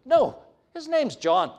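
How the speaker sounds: high, emphatic, foot-stomping refusal; foot-stomping insistence. Acted. Elizabeth stomping her foot at the relatives, emphatic insistence. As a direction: stamp it out, pitched right up. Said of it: "almost have a sense of her stomping her foot".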